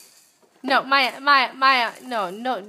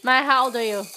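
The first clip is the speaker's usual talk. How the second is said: ordinary talk, nothing marked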